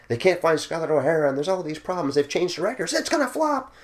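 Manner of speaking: mock tabloid alarm rising to a squeal; mock press panic. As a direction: build a hysterical press scare to the end. Mocking gossip press predictions.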